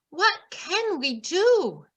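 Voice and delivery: ordinary delivery, nothing marked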